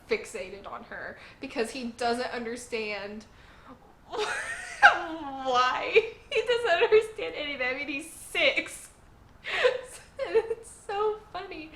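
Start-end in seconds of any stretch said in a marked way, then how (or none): 3.6-9.5 high, loud words bursting through laughter; laughing. Real, not acted. Reviewer finds the six-year-old's confusion hilarious, laughing hard. Cracks up over the boy's confusion. 10.3-11.7 high-pitched, words squeezed through laughter; laughing. Real, not acted. Reviewer tickled by the lovestruck six-year-old, laughing.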